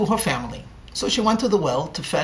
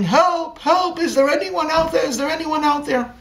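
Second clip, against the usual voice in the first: shouting for help; 10 semitones higher than usual. A narrator's reading. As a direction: call up from the bottom of a well. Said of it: "she began shouting".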